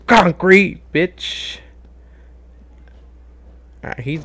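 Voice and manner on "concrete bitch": taunting trash talk. Acted. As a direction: gloat at the opponent. Trash talk while attacking.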